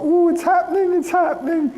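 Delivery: high, over-the-top excited squeak; hammed-up high-pitched gushing; mock emotional squeal. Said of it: "I didn't talk like that".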